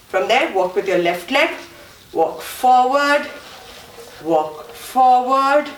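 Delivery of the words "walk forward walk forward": slow, stretched calling on the beat; slow rhythmic instruction; drawn-out step cue. Shown as a demonstration. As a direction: draw out "forward" with each step. Calling out steps in time.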